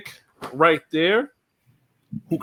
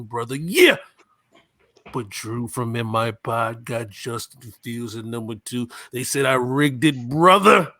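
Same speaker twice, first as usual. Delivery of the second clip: Macho Man Randy Savage. Acted. Podcaster pitching his draft picks to the group, wrestler impersonation. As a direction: cut a wrestling promo, punching the catchphrases. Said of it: "Macho Man Randy Savage impersonation".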